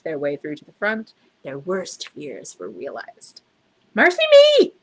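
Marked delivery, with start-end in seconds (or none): 3.9-4.7 very high, loud shriek of alarm; shocked cry. A narrator's reading. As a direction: housekeeper crying out in horror. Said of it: "cried Missus Bird".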